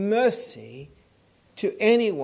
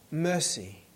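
usual speaking voice

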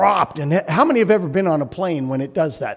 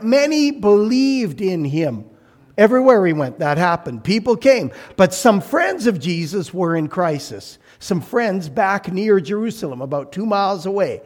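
usual speaking voice

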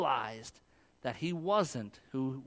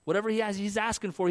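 normal talking voice

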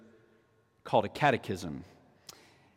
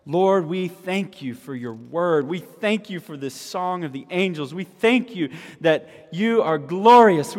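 rising, louder earnest prayer; fervent prayer; 6 semitones higher, 8 dB louder than usual. Acted. Pastor praying over congregation, fervent prayer. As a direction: plead with God for the hurting.

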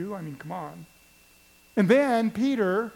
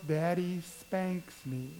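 put-on soft, slow little girl voice; timid child answering her father; small child; 2 semitones lower, 6 dB quieter, slower than usual. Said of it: "And she goes".

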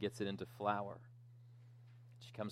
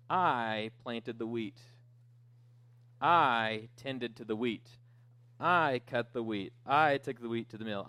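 emphatic declaration, leaning hard on each "I"; indignant little hen; 3 semitones higher, 6 dB louder than usual. Acted. Little hen tells the lazy animals she eats alone, indignant.

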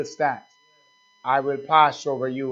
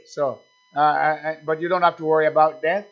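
ordinary talk, nothing marked

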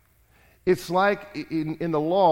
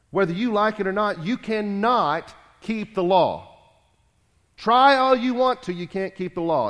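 forceful preaching; 4 semitones higher, 4 dB louder than usual. Acted. Preacher presses the point.

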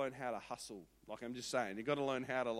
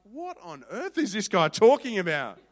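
1 mock puzzled; 4 semitones higher, 16 dB louder than usual. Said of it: "looking at me like".

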